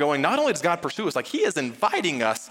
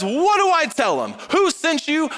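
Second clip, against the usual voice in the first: high, loud, exasperated asking; urgent pleading question; 12 semitones higher, 4 dB louder than usual. Acted. Moses protesting to God about the people's question, urgent pleading. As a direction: cry it out, demanding an answer.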